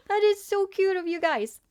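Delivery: high, loud gushing to chat; real delight; excited gushing. Real, not acted. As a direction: warm excited praise. Touched by viewers' gesture.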